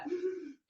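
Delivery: quick high gasp of alarm; sharp panicked gasp. Retold or imitated. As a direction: suck in air in fright. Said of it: "I'd be going".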